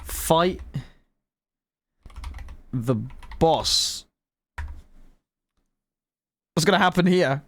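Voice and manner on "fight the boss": drawn out, word by word; slow dictation. Real, not acted. Player typing a command to fight the boss, slow dictation. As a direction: say it slowly as if typing along.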